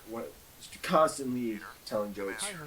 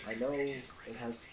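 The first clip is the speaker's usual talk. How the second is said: whisper; 8 dB quieter than usual. Acted. Clerk admits it.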